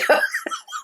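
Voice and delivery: a squeaky burst of laughter; high squeaky laugh. Real, not acted. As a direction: giggle up high. Laughing at own joke.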